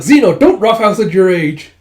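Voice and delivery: acted loud reprimand; scolding. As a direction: scold him in mock exasperation.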